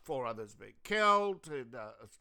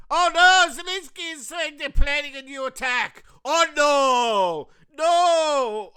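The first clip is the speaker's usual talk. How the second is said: high, loud sarcastic wailing; sarcastic mock alarm; 9 semitones higher, 12 dB louder than usual. Mocking Zelensky's insight, loud high sarcasm. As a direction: ham up fake shock to mock him. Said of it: "mocking Zelensky's warning".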